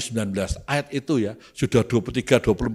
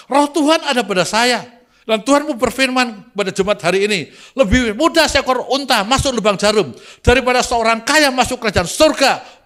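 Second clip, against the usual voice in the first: high, ringing preacher's proclamation; fired-up pulpit declaration, pitched high; fiery experienced preacher; 12 semitones higher, 6 dB louder than usual. Retold or imitated. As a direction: proclaim it like a seasoned fiery pastor. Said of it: "Berapi-api saya ngomong".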